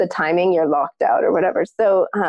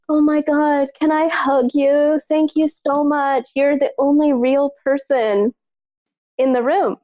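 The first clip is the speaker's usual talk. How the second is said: higher, slow, gushing voice; gushing woman; 6 semitones higher than usual. Retold or imitated. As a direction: play the overjoyed stranger, drawn out. Woman gushes, wants to hug.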